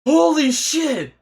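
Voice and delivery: high, drawn-out exclamation; awestruck. Real, not acted. Viewer stunned by the fight, awestruck cry. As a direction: let it out slowly in high-pitched amazement.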